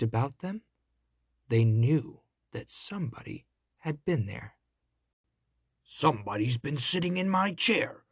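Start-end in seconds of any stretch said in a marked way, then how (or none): 5.8-8.0 great big growly bear voice; papa bear's big growl; growling father bear. A narrator's reading. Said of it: "growled the father bear in his great big voice".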